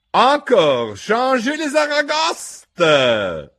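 loud, high, long-held yell. A narrator's reading. Gryphon yells to change lobsters, loud stretched shout. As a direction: bellow the call, dragging out the last word. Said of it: "urlò il grifone".

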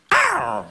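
high yelp of a dying man; mock dying howl. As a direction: let out a short high howl. Mock death cry.

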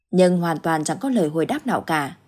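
usual speaking voice

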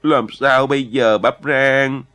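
loud, slow, wailing plea; near tears. A narrator's reading. Anxious boy begs Bắp Rang for a way out, near tears. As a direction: draw it out as if about to cry. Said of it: "hỏi bằng giọng sắp khóc".

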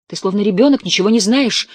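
loud scolding. Acted. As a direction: scold her like a naive child, full voice. Husband scolding wife in quarrel.

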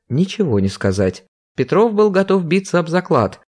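ordinary delivery

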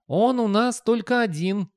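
very high, slow youth voice; skinny youngster. A narrator's reading. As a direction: explain in a high, drawn-out young voice. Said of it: "сказал юнец".

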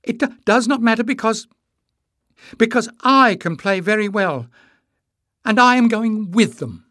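very high, loud, halting declaration; stumble, then force it out boldly; stammering defiance. A narrator's reading. Emless announcing to his mother he is going, stammering defiance. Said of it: "Emless stammered, meeting his mother's angry stare defiantly".

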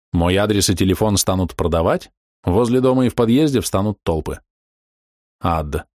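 ordinary delivery

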